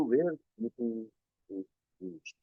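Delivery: ordinary talk, nothing marked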